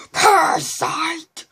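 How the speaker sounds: loud, high, drawn-out bellow; stretched-out furious yell; outraged roar. Retold or imitated. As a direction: roar it like an insulted monster. Retelling Venom's outraged outburst.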